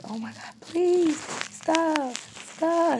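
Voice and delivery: high, loud pleading, retold; frightened retold plea; retold begging. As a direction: beg him to stop, voice climbing. Begging attacker to stop.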